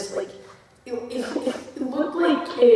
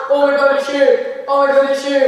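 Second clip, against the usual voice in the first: mock panic; 3 semitones higher, 8 dB louder than usual. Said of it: "I've never seen a panic".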